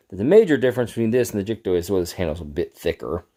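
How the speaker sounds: ordinary delivery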